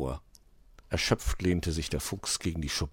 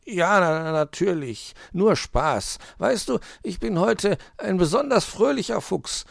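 halting start, high forced jollity; stammer, then fake being jolly; unconvincing cheer; 12 semitones higher, 5 dB louder than usual. A narrator's reading. Fox claims to Weißohr he's cheerful, unconvincing. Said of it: "doch es klang nicht danach".

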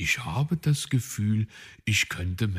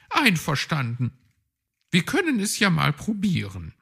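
magpie agreeing; 9 semitones higher, 3 dB louder, faster than usual. A narrator's reading. Frau Elster agreeing.

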